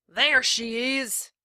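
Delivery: angry mob leader shouting. A narrator's reading. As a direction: point her out to the mob with a yell. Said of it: "Trout shouted".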